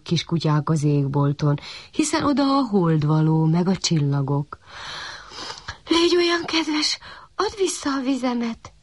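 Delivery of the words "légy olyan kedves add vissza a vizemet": high little puppy begging; puppy begging the sun for water; pleading. A narrator's reading. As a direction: coax the sun sweetly. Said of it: "kérlelte a kiskutya".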